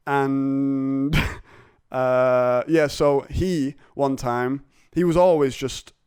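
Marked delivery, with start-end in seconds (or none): none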